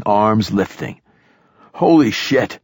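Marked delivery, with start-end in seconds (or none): 1.7-2.6 high, startled exclamation; shouted. A narrator's reading. Companions as the monster bursts from river, shouted. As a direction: blurt it out high, moderately raised. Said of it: "which one of us shouted".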